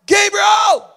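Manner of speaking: a loud, high yell of the son's name; calling out. Retold or imitated. Father yelling for his son who took the phone, loud high call. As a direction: summon him from the next room.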